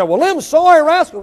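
loud, high-pitched mock complaint; indignant outburst. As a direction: blurt it out like an affronted churchgoer. Acted outburst of resentful churchgoer.

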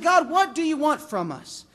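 high, loud plea of protest; acted indignant complaint; sarcastic exasperation. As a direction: demand an answer, fed up. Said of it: "speaks is so sarcastic to God".

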